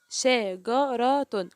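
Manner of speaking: "شجرة" very slowly, each letter with its vowel apart; teacher sounding out "شجرة" piece by piece; syllable by syllable. Shown as a demonstration. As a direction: break "شجرة" into separate syllables, pausing between them. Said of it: "كل حرف نطقته لوحده".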